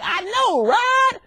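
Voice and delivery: very high, incredulous agreement; mock exasperated agreement. Moses agrees with baffled slaves.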